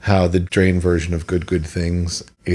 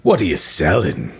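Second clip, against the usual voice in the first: Cockney merchant voice. Retold or imitated. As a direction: play the game's Cockney merchant. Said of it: "when I do my Resident Evil four merchant voice".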